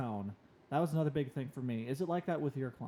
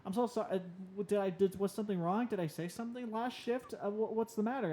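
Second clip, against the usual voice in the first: high, flustered rush of apologies; mock panicked coworker apologizing to bartender; freaking out; 6 semitones higher than usual. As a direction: panic and apologize all at once, voice pitched up. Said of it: "and then I start freaking out".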